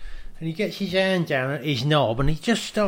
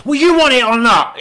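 higher, louder impatient push; pushy demand; 8 semitones higher, 8 dB louder than usual. Retold or imitated. Salesman pressing a buyer for an answer, loud and impatient. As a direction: snap back like a hard-sell trader. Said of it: "He goes".

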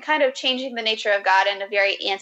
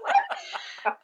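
laughing; 8 semitones higher, 4 dB quieter than usual. Real, not acted. Laughing at her backtrack.

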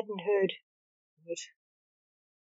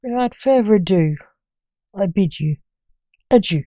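dramatic intro; 12 dB louder than usual. Acted. Podcast intro.

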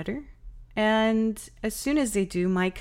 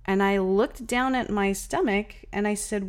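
ordinary talk

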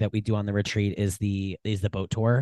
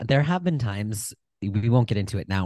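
ordinary talk, nothing marked

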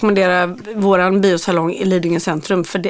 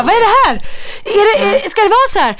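put-on confused child; 12 semitones higher than usual. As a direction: whine like a boy confused by the wrong film. Sons confused by short film.

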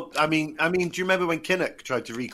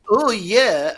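loud, high mock rally cry; Kinnock's "oh yeah"; 8 semitones higher, 10 dB louder than usual. Kinnock on his podium crying out before falling in, loud. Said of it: "and then go".

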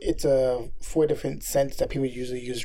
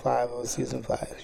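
ordinary delivery

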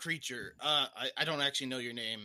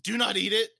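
firm command; 6 semitones higher, 7 dB louder than usual. Acted. Ordering the dog loudly.

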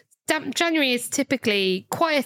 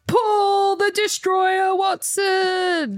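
wrestling announcer shout; 9 semitones higher, much slower than usual. Acted. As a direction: roar it like a WWE ring announcer. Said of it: "I've just said".

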